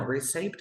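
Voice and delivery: receipt mispronounced "ri-SAYP"; receipt with "ay" and an audible P; wrong pronunciation. Shown as a demonstration. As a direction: voice the silent P in receipt. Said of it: "it doesn't exist".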